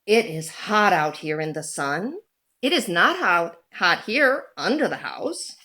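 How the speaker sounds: ordinary delivery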